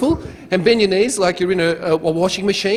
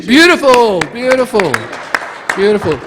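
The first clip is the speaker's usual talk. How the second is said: loud, high cheer for the crowd; elated praise; 6 semitones higher, 8 dB louder than usual. Real, not acted. Cheering audience after chant.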